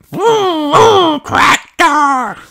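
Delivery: put-on very high little-boy cry; toddler George crying out. A narrator's reading. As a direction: squeal it like an excited toddler. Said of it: "cried George".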